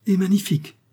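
excited exclamation. A narrator's reading. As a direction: burst out with delight. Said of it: "s'écria l'hôte". (s'écrie, ravi et fort)

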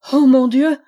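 anguished dramatic exclamation; despairing cry. A narrator's reading. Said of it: "s'écria-t-elle avec désespoir".

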